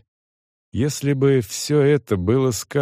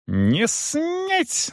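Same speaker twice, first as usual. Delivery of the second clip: very high, strained outburst; furious yell; 3 dB quieter than usual. A narrator's reading. Young man forbids the manager's grin, furious. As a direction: cry out in outrage. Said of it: "заорал тот".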